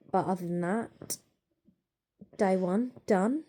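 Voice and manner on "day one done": drawn-out cheerful sign-off; slow cheerful. Real, not acted. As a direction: stretch it out happily. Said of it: "repeated slower, brighter".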